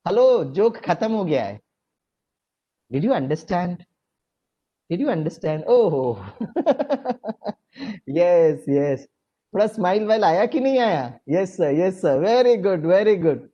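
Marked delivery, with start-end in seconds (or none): none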